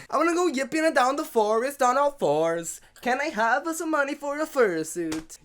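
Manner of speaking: high-pitched mock begging; mock pleading child. Host mimicking daughter asking to go yipping, mock high pleading.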